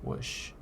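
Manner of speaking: breathy whoosh sound effect; soft mouth whoosh; whispered whoosh. Pretend. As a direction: make a whispered whooshing noise.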